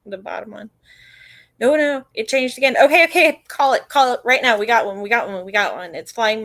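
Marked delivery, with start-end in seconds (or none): 1.6-4.6 excited. Real, not acted. Urgent call to end the poll.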